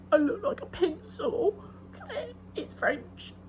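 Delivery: high, laughing-crying delivery of the quote; hammed-up tearful whine; Fleabag quote.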